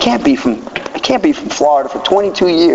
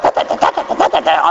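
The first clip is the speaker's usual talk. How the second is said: mock whispered chatter; 4 semitones higher than usual. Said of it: "They're like".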